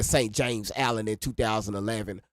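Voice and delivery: normal talking voice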